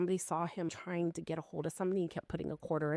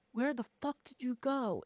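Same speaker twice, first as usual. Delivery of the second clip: higher, acted alarmed challenge; retold frantic demand; 7 semitones higher than usual. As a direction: play a boyfriend hunting an intruder. Boyfriend searching for an intruder.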